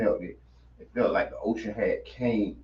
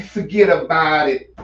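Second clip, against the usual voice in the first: put-on louder New Jersey mob accent; Vinny impression; 3 semitones higher, 7 dB louder than usual. Host hamming up Vinny's forget about it. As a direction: lean into the Jersey Shore swagger. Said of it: "I feel like Vinny".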